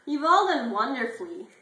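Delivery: Brittany gushing loudly at the class; loud gushing praise. Acted. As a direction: belt the praise like a fake-sweet teacher. Brittany praises, snubbing T-Bone.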